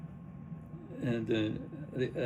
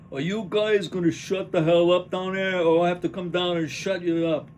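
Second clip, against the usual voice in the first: loud, higher yell down at the noisy audience; offstage shout; 5 semitones higher, 8 dB louder than usual. Acted. Angry neighbour yelling down at the chatter, loud and high. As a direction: bellow it up from above to shut them up. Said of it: "Voice up".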